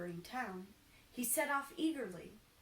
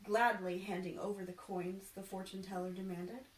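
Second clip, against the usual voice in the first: ordinary delivery, nothing marked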